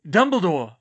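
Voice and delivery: loud, high call across the room. Acted. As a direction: call out to get his attention. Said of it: "he called".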